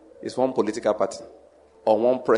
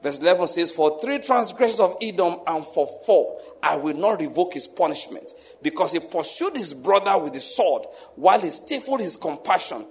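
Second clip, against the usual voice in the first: raised, intense preaching read; scripture reading; 6 semitones higher, 3 dB louder than usual. Shown as a demonstration. Preacher reading Amos judgments to the congregation, booming. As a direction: proclaim the prophecy with force. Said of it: "I can continue reading like that".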